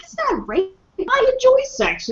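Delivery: high-pitched mocking quote. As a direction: squeak it out in a silly voice. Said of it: "and then they're like".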